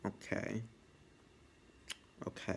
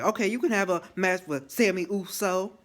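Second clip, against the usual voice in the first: mock exasperated agreement; 7 semitones higher, 6 dB louder than usual. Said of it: "Adam's like".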